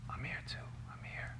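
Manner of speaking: soft whisper into a buddy's ear. Retold or imitated. To his friend Omar in the dark truck, a whisper. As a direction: breathe it so the police won't hear. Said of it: "And I whisper in his ear".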